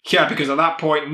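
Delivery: ordinary delivery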